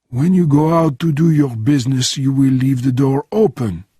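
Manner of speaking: put-on French-accented old hand giving orders; matter-of-fact French-accented instructions; old Frenchman, matter-of-fact. Bernardin explains guarding the room, French-accented and matter-of-fact. Voices Bernardin planning defence.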